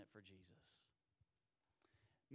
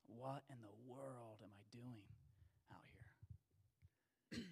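panicked whisper; 3 semitones lower, 13 dB quieter than usual. Retold or imitated. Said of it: "start hyperventilating".

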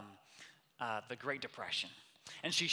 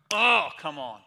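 much louder burst of delight; excited exclamation; 14 dB louder than usual. Real, not acted. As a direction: shout it out in joy. Excited reaction to quote.

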